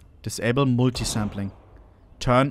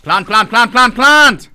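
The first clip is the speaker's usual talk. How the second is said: shouting; 9 semitones higher, 9 dB louder, slower than usual. Real, not acted. Urging teammate to plant.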